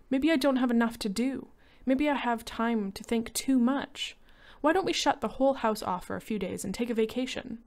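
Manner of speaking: anxious woman pointing out lions; wife's voice. A narrator's reading. Lydia voiced by the reader.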